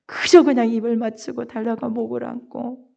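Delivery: ordinary talk, nothing marked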